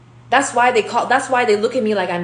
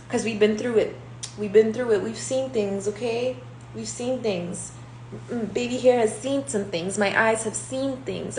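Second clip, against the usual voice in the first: normal talking voice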